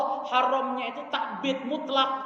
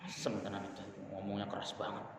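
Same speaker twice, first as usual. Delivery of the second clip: low, much softer offended mutter; offended grumble; 12 semitones lower, 12 dB quieter than usual. Retold or imitated. Preacher mimics a listener offended by harsh advice, low grumbling mutter. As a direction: grumble it under your breath, put out.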